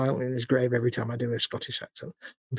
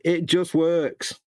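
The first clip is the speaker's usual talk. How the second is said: slow, deliberate, lightly mimicked catchphrase; a hint of a keynote presenter, drawn out slowly; Todd Howard; 3 semitones higher, much slower than usual. Quoting a game developer's catchphrase, slow mild impression. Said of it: "As Todd Howard would say".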